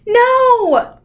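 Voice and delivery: high loud desperate scream. A narrator's reading. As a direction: scream no with all her might. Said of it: "Meg screamed".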